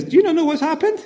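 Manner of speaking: high, incredulous disbelief; astonished question. Acted. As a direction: ask it as if they can't believe anyone missed it. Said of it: "they're saying to Jesus".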